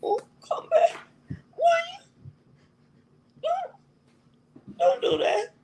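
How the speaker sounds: high, wailing fake sob; mock crying. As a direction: whine it out like a hammed-up crybaby begging him back. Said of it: "You crying".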